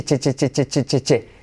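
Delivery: "che" tapped out evenly, crisp ch stop; machine-like run of "che"; repeated CH drill. Shown as a demonstration. Teacher drilling learners on ch, repeated crisp syllable. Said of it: "Cuidado no decir t-shirt".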